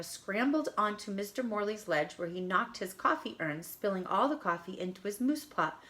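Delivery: plain reading voice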